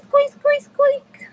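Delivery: very high, loud squeak imitation; squeaky toy. Shown as a demonstration. Explaining to viewers what a squeaky squeaky is, high squeaks. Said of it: "that goes squeak".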